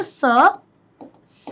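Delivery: स said alone as a short "suh"; isolated letter sound. Shown as a demonstration. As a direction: sound out स for the class, separately. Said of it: "sound क्या आ रहा है".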